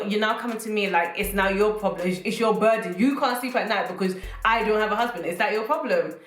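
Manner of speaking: ordinary talk, nothing marked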